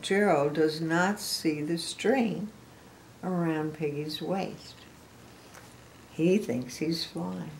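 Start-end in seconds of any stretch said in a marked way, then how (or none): none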